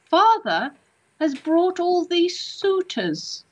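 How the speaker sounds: high, drawn-out imitation of a lady in a castle; regressed castle lady. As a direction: voice a lady of long ago, high and slow. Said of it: "I'll always remember how she said it".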